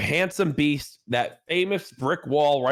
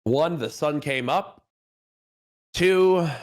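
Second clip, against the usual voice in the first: slow, high showman's opening; announcer intro; 6 semitones higher than usual. Acted. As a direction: welcome the audience like a host.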